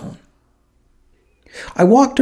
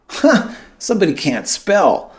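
amused remark; 6 semitones higher than usual. A narrator's reading. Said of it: "I said out loud".

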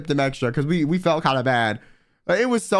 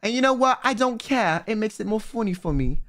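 lightly put-on British accent, higher; defiant in a mock British accent; bad British accent; 4 semitones higher than usual. Streamer answering a viewer's jibe, hammed British accent.

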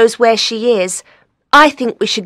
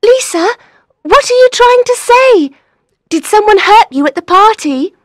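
high, loud, anxious questions; urgent concern; worried questioning; 5 dB louder than usual. Acted. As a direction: press her anxiously for an answer. Friend questions frightened crying caller.

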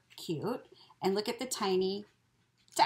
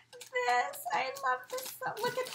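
delighted squeal; 14 semitones higher, 3 dB quieter than usual. Real, not acted. Delighted by gift item.

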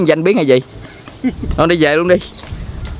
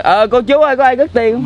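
higher-pitched mock calling to passers-by; demonstrated call-out; 5 semitones higher than usual. Shown as a demonstration. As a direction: lightly act out asking around, pitch raised. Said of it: "mày thấy tiền phải la lên".

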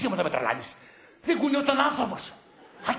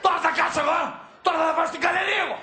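raised, higher voice, fired up; angry shouting; 4 semitones higher, much faster than usual. Acted. Partner threatening to storm the gallery at night, shouting. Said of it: "φωνάζεις".

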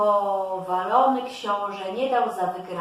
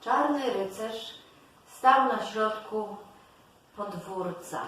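ordinary delivery

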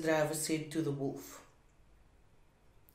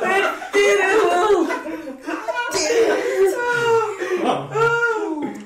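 slow high laughing groan; 13 semitones higher, 7 dB louder, much slower than usual. Real, not acted.

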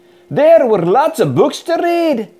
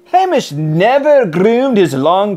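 ordinary delivery

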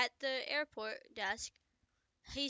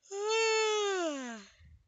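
big loud yawn, pitched high; 9 semitones higher, 6 dB louder than usual. Real, not acted. Reader breaking off mid-story, long loud yawn. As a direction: let out a long, high yawn that falls away. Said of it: "stop yawning".

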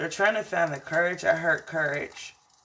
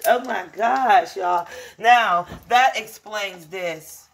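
excited; 3 semitones higher, 7 dB louder, much slower than usual. Real, not acted. Excited realization, loud and slow.

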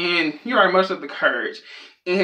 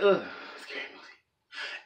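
hushed, slow whisper of dread; scared moviegoer; 11 dB quieter, slower than usual. Acted. Person who dislikes scary movies reacting, hushed dread. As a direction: breathe it out softly, recoiling. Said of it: "one of those people that's like".

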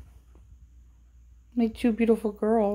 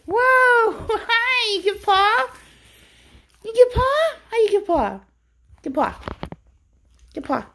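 high and louder, gushing at the dog; excited pet talk; 13 semitones higher, 5 dB louder than usual. Real, not acted. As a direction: cheer the dog on in a raised voice. Greeting and asking dog for paw.

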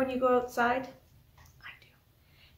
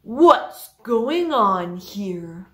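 stern principal; 4 semitones lower, 7 dB louder, much slower than usual. Acted. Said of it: "The principal said".

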